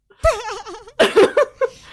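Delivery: high rising laugh; giggling burst. Real, not acted. Laughs at Asia episode idea.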